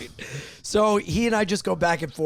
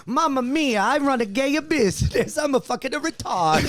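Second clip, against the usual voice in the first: lightly higher mock Italian; a hint of an Italian accent; 7 semitones higher than usual. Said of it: "sauce gorilla".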